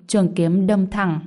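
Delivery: ordinary delivery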